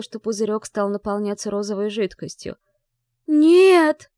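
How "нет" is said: high, very loud anguished scream. Acted. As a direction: scream it out in horror. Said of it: "мысленно закричала".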